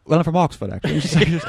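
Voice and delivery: put-on a light posh British accent, laughing through it; lightly done Oxford English accent. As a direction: fake a mild Oxford English voice.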